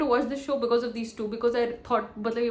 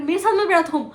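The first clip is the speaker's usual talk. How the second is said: higher, louder mock yell at a friend; a hammed-up shout telling her friend not to sit with her; 6 semitones higher, 5 dB louder than usual. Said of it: "चिल्ला के बोल रही".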